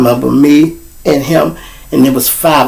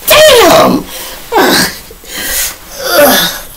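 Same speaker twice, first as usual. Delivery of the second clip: flustered voice; 11 semitones higher than usual. Retold or imitated. Retelling panic after fight.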